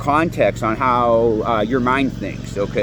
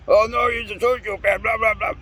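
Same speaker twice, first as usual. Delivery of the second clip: mocking whiny voice; 9 semitones higher than usual. Mocking people who excuse sociopaths.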